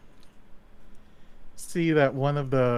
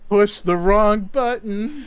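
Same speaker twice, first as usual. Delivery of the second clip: loud high laughing wail; 9 semitones higher, 8 dB louder than usual. Real, not acted. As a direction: cry it out through laughter. Laughing at outcome.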